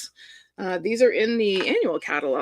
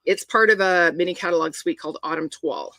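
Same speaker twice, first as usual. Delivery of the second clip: usual speaking voice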